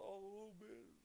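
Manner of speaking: high-pitched imitation of someone panicking; put-on distressed whine; mock freak-out. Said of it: "they're full freaking out".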